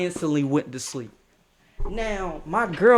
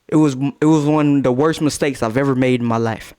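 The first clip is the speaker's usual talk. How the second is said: usual speaking voice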